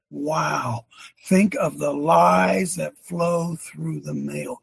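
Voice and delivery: ordinary delivery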